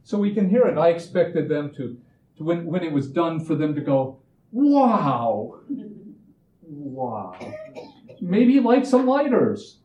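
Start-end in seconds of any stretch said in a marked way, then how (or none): 4.5-7.4 a hammed-up wow, then a hushed one; mock awe. Band members gushing over the song, mock awe. Imagined awed listeners. 8.2-9.8 laughing through the words, high and loud. Real, not acted. Joking to the audience about lighters, laughing loudly.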